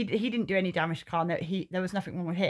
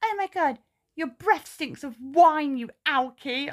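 much higher sneering voice; mocking the driver; 7 semitones higher, 4 dB louder, slower than usual. Teller mocks the driver's insult in a high sneer. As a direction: mimic her snotty jab. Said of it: "she was literally like".

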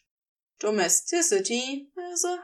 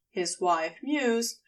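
plain reading voice

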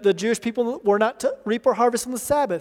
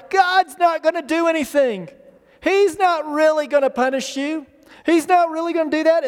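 higher-pitched, brazen denial; the tempting enemy; 5 semitones higher than usual. Acted. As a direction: scoff at the threat as the tempter, voice lifted. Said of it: "Listen to what the enemy says here".

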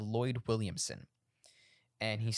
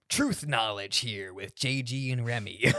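mock show announcer; 3 dB louder, slower than usual. Mock podcast intro.

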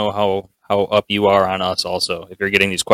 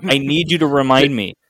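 high-pitched, exasperated self-defence; worked-up, high-voiced indignation; exasperated protest; 7 semitones higher than usual. Real, not acted. Protests blame for forgetting music.

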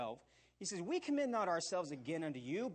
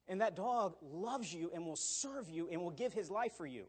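ordinary talk

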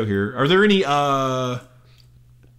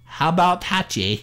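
put-on Cockney-ish Australian accent; 4 semitones higher than usual. Podcaster jokingly names the duo, in bad Australian accent. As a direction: attempt Australian and land near Cockney. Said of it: "It's in some Cockney accent".

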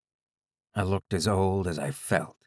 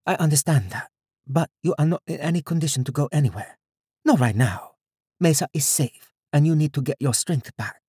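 higher-pitched woman, firm but caring; concerned woman caring for patient; 7 semitones higher than usual. Acted. Woman telling her patient he is unfit to go, higher voice, firm concern.

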